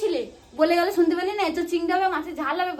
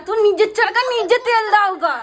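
loud, scornful telling-off; angry retort; 6 dB louder than usual. Acted. Telling a relative to mind their business, angry retort. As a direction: bellow it to shut the other down.

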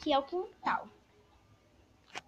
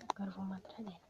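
whisper; 5 semitones lower, 13 dB quieter, much faster than usual. Acted. Sneaking behind the villain.

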